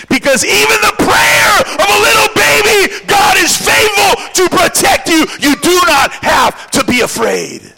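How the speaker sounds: building from firm to a shouting climax; preaching at full force. Acted. Preacher rallying the congregation at full shout.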